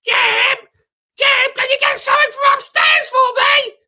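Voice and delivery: loud high call upstairs; shouting Kate. Retold or imitated. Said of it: "Kate's like".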